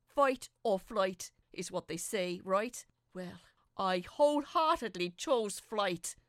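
intense climax narration. A narrator's reading. Fleeing the ghost.

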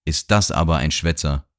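usual speaking voice

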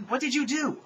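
high, loud accusing shout; angry yell. Acted. Heroine yelling at Rudolpha, high and angry. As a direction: demand it in outrage. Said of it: "I yelled angrily".

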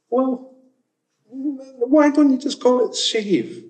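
high, slow, incredulous complaint; exasperated. Real, not acted. Speaker venting at jargon-filled products, high exasperated plea. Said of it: "ranting about jargon".

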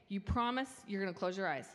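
normal talking voice

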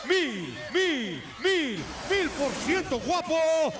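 slow, shouted ring introduction; lucha libre announcer. Acted. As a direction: hype the crowd like a ring announcer.

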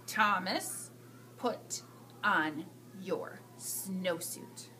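low, soft, very slow stern warning; stern principal. Acted. Principal giving Thomas his sternest warning. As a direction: drop it down like an authority figure. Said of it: "his very best principal look".

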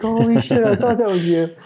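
high-pitched retold amazement; excited recognition. Replying to an acquaintance who recognized him, high excited surprise.

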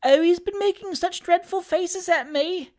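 put-on very high childish wail; crying boy. A narrator's reading. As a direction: complain tearfully like a scared child. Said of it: "cried the boy".

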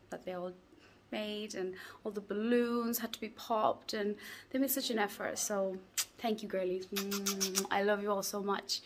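soft, hushed vlog talk; hushed whisper. Real, not acted. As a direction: keep it to a quiet morning murmur. Quiet morning vlog, whispering.

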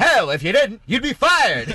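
put-on high, loud old-time boss; laughing old-timey tough guy. Joins the old-timey voice game.

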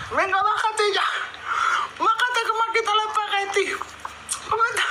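muy aguda, cariñosa, hablando a un perro; voz para mascotas. Acted. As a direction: como a un cachorrito mimado. Hablando a la perra. (voz aguda de hablarle a la perra)

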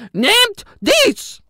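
high, loud spooky ghost cry; a ghost thrusting a cross, ordering loudly; ghost commanding. A narrator's reading. Ghost forcing a cross on the vampire twins, high loud command.

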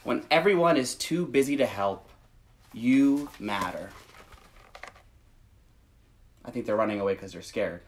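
gentle, measured picture-book read; reading to children. Acted. Reads picture book aloud.

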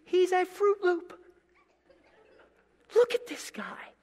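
high, snide, fading to a whisper; snide inner thought. Retold or imitated. Cashier judging a customer in his head, high and snide. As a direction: sneer under your breath. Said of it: "I'm thinking to myself".

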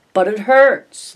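put-on whining child. Child wailing at father.

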